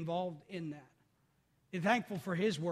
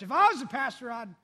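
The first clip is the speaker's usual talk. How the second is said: mock church critic; 3 semitones higher, 9 dB louder than usual. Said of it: "it does the church".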